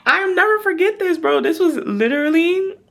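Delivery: high, loud and heated; worked up. Real, not acted. Telling viewers about the roommate incident, high and loud. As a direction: let it burst out of you.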